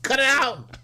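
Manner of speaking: loud, high-pitched telling-off; high exasperated yell; exasperated shout. Acted. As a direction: yell it as if fed up with her antics. Repeats telling-off, much louder.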